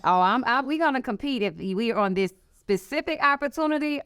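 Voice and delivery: hammed-up competitive boast, bright and pushed up; playful taunt. Telling rival firms she's coming for them, mock taunt.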